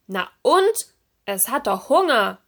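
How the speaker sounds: loud, indignant retort; a bit angry. A narrator's reading. Lanternfish scolding Serena about the hungry baby, a bit angry. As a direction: snap back with mild anger. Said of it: "klang sogar ein bisschen wütend".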